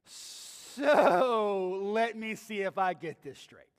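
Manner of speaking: slow, loud, mocking voice; dripping sarcasm. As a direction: lay the scorn on thick. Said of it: "feel the sarcasm dripping off of Pilate".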